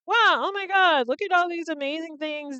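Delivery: high, fast burst of fake awe; sudden, rushed mock wonder; mock amazement. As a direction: gush like a dazzled onlooker. Mock awe at European benefits.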